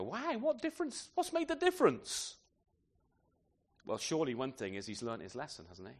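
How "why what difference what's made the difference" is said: high-pitched, astonished questions; amazed questioning. Acted. Listener puzzling over the changed apostles, amazed questioning. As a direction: pitch it right up in wonder.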